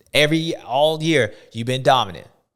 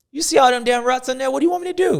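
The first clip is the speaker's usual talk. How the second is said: mock exasperated; 7 semitones higher, 4 dB louder, faster than usual. Lap rider complaining.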